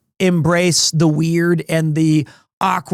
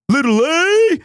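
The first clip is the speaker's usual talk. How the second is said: silly reading voice; 7 semitones higher than usual. Acted. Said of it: "f-ing hates that voice".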